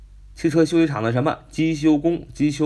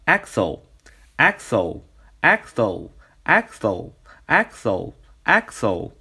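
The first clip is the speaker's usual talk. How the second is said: slow drill of axle, stress on the first syllable; repeated slow drill; much slower than usual. Shown as a demonstration. Teacher drilling students on axle, slow and stressed on AX. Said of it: "重音在最开头".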